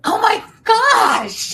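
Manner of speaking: a loud, high cry of shock; real astonishment bursting out; stunned shout. Real, not acted. Host hears the surprise name, loud high stunned cry.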